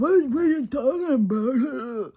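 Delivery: high, loud whiny protest; indignant outburst. Retold or imitated. Imitating her drunk partner's high, loud indignant protest.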